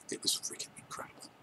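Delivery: hushed, awed whisper; a real, breathy whispered rave. Real, not acted. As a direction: whisper it like a secret treasure. Hushed rave about the popcorn.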